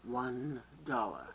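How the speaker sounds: very slow, low deadpan "One dollar"; slow deadpan clerk. Shown as a demonstration. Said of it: "Now you".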